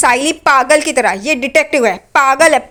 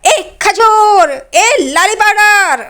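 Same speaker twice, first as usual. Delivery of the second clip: loud, much higher, drawn-out taunting yell; mock shout; 8 semitones higher, 4 dB louder, much slower than usual. Sachin taunting Renuka with nicknames, slow loud shout. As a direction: bellow the nicknames slowly to mock her. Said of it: "चिल्लाता है".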